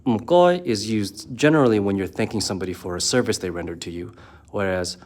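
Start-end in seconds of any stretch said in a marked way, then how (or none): 0.1-0.7 "Mkoy" said high and loud with a Cantonese tone; Cantonese m goi. Shown as a demonstration. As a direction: pitch "Mkoy" up high, a crisp Cantonese syllable pair. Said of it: "the two expressions".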